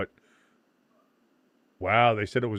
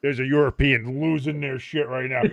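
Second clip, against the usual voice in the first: ordinary delivery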